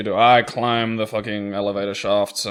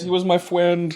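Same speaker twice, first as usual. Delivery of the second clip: higher mock grieving voice; mock mournful; 7 semitones higher than usual. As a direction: pretend to mourn a lost friend. Voicing a character's mock grief.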